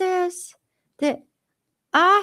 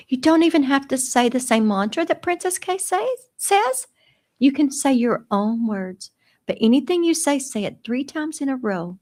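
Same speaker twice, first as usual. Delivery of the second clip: ordinary talk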